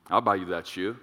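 low, smooth husband voice; sweet-talking husband. Acted. Husband promising his wife that shoe, sweet-talking. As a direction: croon it low to your wife. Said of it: "That's how I talk to my wife".

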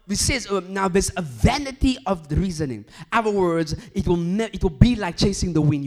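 loud, high, driving sermon delivery; full-force preaching. Acted. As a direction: preach it out to the whole hall.

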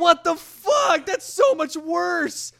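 high, slow, loud outcry; horrified shout. Real, not acted. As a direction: cry out in disgust. Horrified at the teeth scene.

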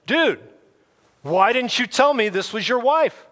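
exasperated Dude. Acted. King confronting Abraham about his wife, high and exasperated.

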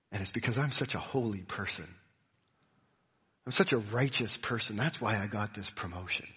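low, hushed self-congratulation; mock-humble brag, kept low and soft; quiet boast. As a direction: confide it softly and low, like a private boast. Imagined boast to best friend over coffee.

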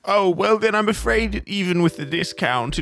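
high, fussy cabbie voice; reluctant cab driver. Acted. Cab driver quotes a higher price.

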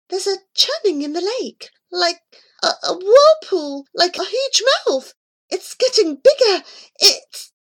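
high cat voice, rising alarm; the cat describing the whirlpool in growing alarm; frightened cat voice. Acted.

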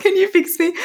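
high, laughing mock plea; anxious client imitated with a laugh; pleading client. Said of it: "People often walk in and say".

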